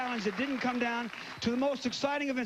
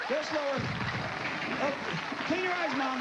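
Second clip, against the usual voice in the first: raised, eager game-show commentary, urging her on; live play-by-play; 5 semitones higher than usual. Real, not acted. Host calling the balloon round to the contestants, excited play-by-play. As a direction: call the action as it happens, pitch up.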